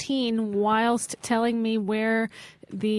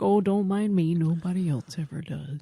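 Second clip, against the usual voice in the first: mopey self-pity; 2 semitones lower than usual. Pretend. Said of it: "the other one is like".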